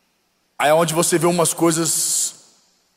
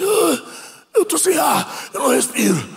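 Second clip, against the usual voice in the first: high strained cry of no air, much higher; gasping demon; 9 semitones higher than usual. Retold or imitated. Demon pinned by an angel, gasping high panicked cry. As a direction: choke it out as if the chest is crushed. Demon voiced while chest sinks.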